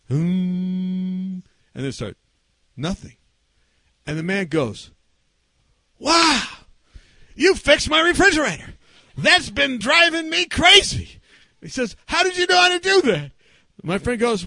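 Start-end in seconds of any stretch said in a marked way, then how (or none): 0.1-1.4 long steady hummed note; imitated hum. Pastor imitates the refrigerator noise, droning hum. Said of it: "and the sound goes away. He goes". 6.0-11.2 high, loud mock astonishment; amazed. Man sees his refrigerator fixed, exaggerated amazement. 12.1-13.3 very high, loud incredulous question; hammed-up disbelief; astonished. Man asks friend about the fix, astonished.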